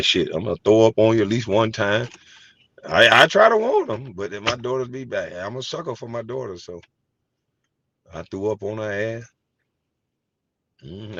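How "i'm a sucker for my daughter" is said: usual speaking voice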